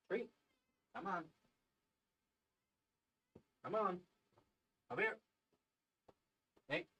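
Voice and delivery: soft, high coaxing calls; hushed coaxing. Real, not acted. Calling the cat over, soft high coaxing.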